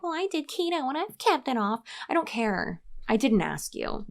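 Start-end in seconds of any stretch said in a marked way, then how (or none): 0.0-1.8 high-pitched mocking know-it-all; mock commenter. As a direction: mimic a boastful commenter, pitched way up. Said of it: "people in the comments who are like".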